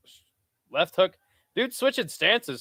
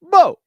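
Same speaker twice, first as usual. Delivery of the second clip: higher and louder, the punch's impact yelled as a boom; excited boom; 12 dB louder than usual. Real, not acted. Streamer reliving the knockout punch, in an excited boom. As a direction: shout the hit like a sound effect.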